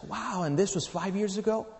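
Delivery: retold surprise, lifting the pitch; surprised. Widow asking the traveler about five years ago, mildly surprised.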